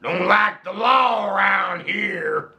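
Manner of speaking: cowboy voice. Acted. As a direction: play a gruff frontier cowboy. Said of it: "You sound like a cowboy".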